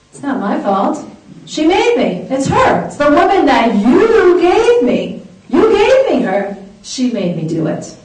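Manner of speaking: acted high-pitched protest, pointing the finger; defensive blame-shifting. Adam blaming his wife when God confronts him, defensive.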